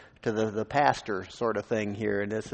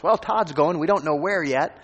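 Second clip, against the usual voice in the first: higher and louder, a proud pulpit announcement; excited announcement; 6 semitones higher, 6 dB louder than usual. Retold or imitated. The pastor announcing Todd's leaving to the congregation, higher and louder. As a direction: announce it to the whole church with a lift. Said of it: "The pastor announced".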